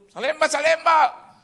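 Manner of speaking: loud, high destination call; bus conductor's call. Retold or imitated. Conductor of an empty bus seeking passengers, calling out.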